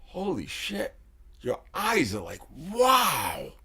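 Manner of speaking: high, loud exclaiming; loud, high awe; ecstatic. Real, not acted. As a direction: burst out in wonder. Ecstatic release reaction.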